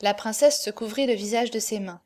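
plain reading voice

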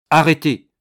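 sudden loud, high order; commanding shout. A narrator's reading. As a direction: halt them with one loud word. Commander halts soldier attacking monk.

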